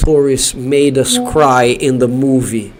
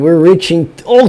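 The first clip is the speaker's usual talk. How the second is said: usual speaking voice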